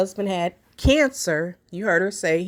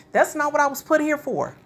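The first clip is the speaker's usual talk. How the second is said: echoing the wife in a higher mock voice; mock declaration, higher; 7 semitones higher than usual. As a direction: commentator mimics her defiant line, higher and mocking. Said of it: "she let it be known".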